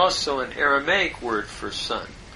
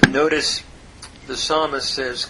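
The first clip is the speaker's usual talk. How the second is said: usual speaking voice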